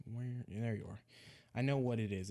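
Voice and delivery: normal talking voice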